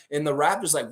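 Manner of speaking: ordinary delivery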